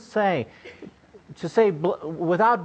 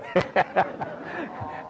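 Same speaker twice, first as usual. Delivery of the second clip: short amused laugh; 3 semitones higher, 4 dB quieter than usual. Real, not acted. Laughing at own story.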